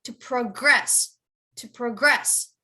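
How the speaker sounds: to pro-GRESS, slowly, weight on GRESS; stress on second syllable. Shown as a demonstration. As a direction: demonstrate progress with the end stressed. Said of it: "the verb".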